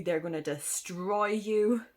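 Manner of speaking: usual speaking voice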